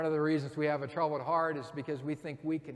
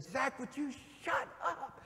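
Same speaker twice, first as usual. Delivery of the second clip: high, slow, exasperated demand; mock exasperation; 9 semitones higher, 4 dB quieter than usual. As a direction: fake losing patience with him. Staged confrontation with student.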